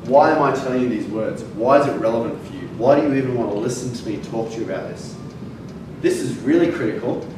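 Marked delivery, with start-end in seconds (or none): none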